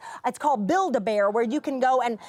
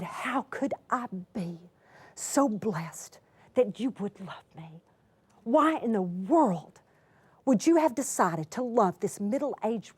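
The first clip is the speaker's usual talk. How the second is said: tearful wonder; 3 semitones lower than usual. Retold or imitated. Asking the little girl why she loves her, tearful. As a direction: marvel through tears. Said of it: "But I burst out in tears".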